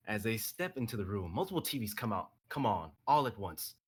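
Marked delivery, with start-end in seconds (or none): none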